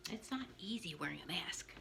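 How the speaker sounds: soft unvoiced aside; breathy confiding whisper. Real, not acted. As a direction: whisper it as a little complaint.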